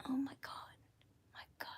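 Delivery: whispered fond scold. Real, not acted. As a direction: hush it, scolding under the breath. Whispering at the naughty pet.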